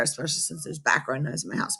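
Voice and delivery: barely audible, let it drop away; very quiet. Shown as a demonstration. Teacher still demoing inaudible speaking, soft low murmur. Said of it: "You probably could not hear much of what I said just there".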